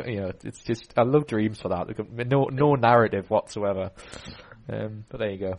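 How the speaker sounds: usual speaking voice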